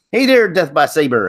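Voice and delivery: easy Southern-drawl hello. Real, not acted. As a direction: greet them with a sudden Southern drawl. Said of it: "I just had a sudden drawl there".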